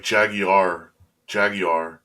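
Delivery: "Jaguar" drawn out as JAG-ee-ar; British-style pronunciation. Shown as a demonstration. Explaining the British car brand, "Jaguar" as a slow JAG-ee-ar. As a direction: say "Jaguar" slowly in three syllables, JAG-ee-ar. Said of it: "you say it with, with kind of, like, the accent".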